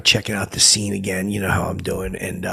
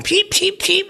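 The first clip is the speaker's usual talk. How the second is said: high chirping cheeps; bird chirps; slower than usual. Retold or imitated. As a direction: peep like a little cage bird. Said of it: "birds going".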